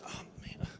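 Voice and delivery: embarrassed whisper. Retold or imitated. Embarrassed aside on phone.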